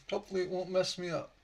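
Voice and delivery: normal talking voice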